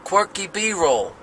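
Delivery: slow, high, badly faked Jersey accent. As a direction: mug a cartoon Jersey guy. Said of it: "Like the worst Jersey accent ever".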